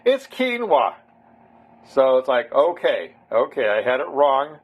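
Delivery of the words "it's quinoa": high-pitched imitation of teasing friends correcting him; mocking friends' correction. Friends in Alaska making fun of his pronunciation, mock correcting. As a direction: mimic friends chiding "no, no", higher than your own voice. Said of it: "started making fun of me".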